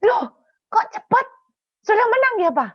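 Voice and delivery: high, loud wifely questioning; astonished nagging wife. Retold or imitated. Wives question their husband back at camp, high astonished voice. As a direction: pounce on him with high-pitched surprise. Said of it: "Istri-istri tanya".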